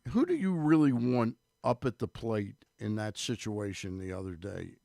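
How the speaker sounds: normal talking voice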